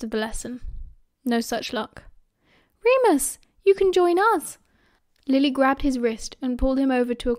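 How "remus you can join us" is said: put-on higher, louder girlish invitation; eager call. A narrator's reading. Lily invites Remus to share her cauldron, eager call. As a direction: call a friend over brightly and high.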